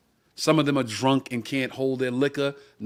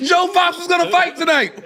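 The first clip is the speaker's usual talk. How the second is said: high, loud and fast; excited disbelief; 13 semitones higher, 5 dB louder than usual. Real, not acted. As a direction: still amazed telling it.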